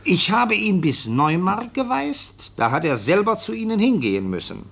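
reading a letter aloud. Acted. Officer reads the foundling's letter to the chief, slow formal reading. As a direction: read out each word slowly and carefully. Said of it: "Ich lese mal vor".

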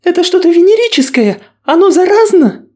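very high, panicked and shrill; terrified horror victim. Acted. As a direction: shriek in disgust and fear. Angelina panics.

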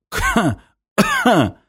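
two short, high coughs; choked cough. Acted. Semyon thrown against the wall, coughing. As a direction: cough it out, winded.

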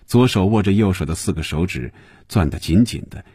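usual speaking voice

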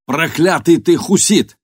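slow, loud, high yell into wind; shouting over wind. A narrator's reading. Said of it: "перекрикивая ветер, заорал кожаный".

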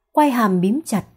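plain reading voice

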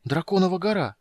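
high-pitched amazed discovery; narrated wonder, pitch lifted; gasped exclamation. A narrator's reading. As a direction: exclaim in astonishment at the sight. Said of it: "ахнул".

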